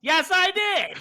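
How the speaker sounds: very high loud yell; shouted answer. Pretend. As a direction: yell the reply. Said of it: "did you put the bin out".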